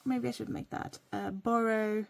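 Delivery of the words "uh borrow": ordinary talk, nothing marked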